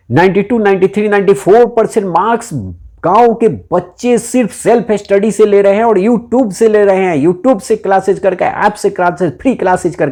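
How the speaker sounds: proud, excited emphasis. Real, not acted. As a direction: lift the voice, boast about them. Proudly praising village children's marks.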